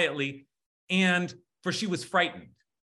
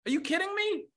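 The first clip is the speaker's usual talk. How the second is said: very high, incredulous exclamation; mock outrage, pitched way up; mad God; 13 semitones higher, faster than usual. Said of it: "God's mad at Abraham".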